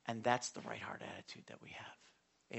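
hushed, breathy point made close; whisper. Real, not acted. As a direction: lean in and whisper the lesson. Quiet point after skit.

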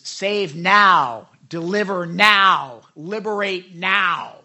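slow, drawn-out chant-like demand; mock crowd cry, hitting each "now"; crowd shouting. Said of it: "And they were shouting".

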